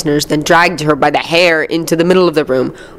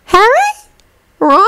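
dreamy distant voice; 4 dB louder than usual. A narrator's reading. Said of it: "the dreamy voice".

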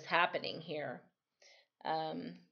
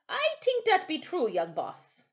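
Timothy voiced; 11 semitones higher, 4 dB louder than usual. Timothy reassures.

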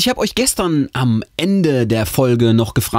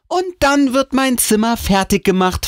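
hoch und bestimmt dazwischenreden; schnell ins Wort fallend. A narrator's reading. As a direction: der Mutter mit hoher Stimme das Wort abschneiden. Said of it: "falle ich meiner Mutter schnell ins Wort". (energisch ins Wort fallend)

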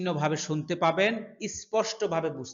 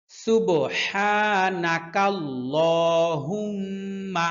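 slow chanted dua in long phrases; longer phrases; 4 dB louder, much slower than usual. Shown as a demonstration. Teacher recites longer parts for memorization. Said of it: "একটু বড় বড় করে পড়বো".

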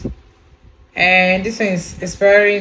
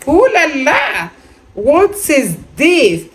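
high, drawn-out ooh la la; a playful gasp of wonder; mock delight; 7 semitones higher, slower than usual. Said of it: "I said".

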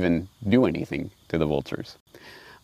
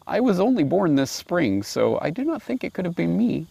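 much higher, gentle innocent lamb voice; polite little lamb; 9 semitones higher than usual. Acted. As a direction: answer sweetly like a small lamb. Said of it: "the lamb replied very politely".

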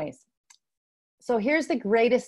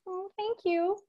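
softened; 10 semitones higher, 4 dB quieter than usual. Retold or imitated. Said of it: "I soften".

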